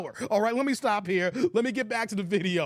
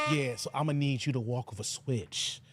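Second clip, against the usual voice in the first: deep, low mock [name] directing; [name] impression; 9 semitones lower, 4 dB quieter than usual. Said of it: "he's like".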